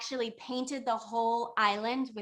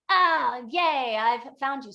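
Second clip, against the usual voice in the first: high, loud burst of mock elation; acted excited cry; 3 semitones higher, 9 dB louder than usual. Traveler finally finds the voices in the sky, elated cry. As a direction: cheer it out loudly as the storyteller's traveler.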